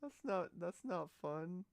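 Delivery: usual speaking voice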